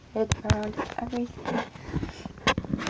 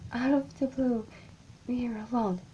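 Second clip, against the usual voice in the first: ordinary delivery, nothing marked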